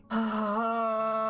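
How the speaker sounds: hammed-up, drawn-out weary sigh; long sigh. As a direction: let out an endless sigh. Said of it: "lets out the world's longest sigh".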